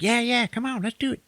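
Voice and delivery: high, eager mock voice; pumped up to go along; a lightly voiced eager young guy; keen sidekick. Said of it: "He's like".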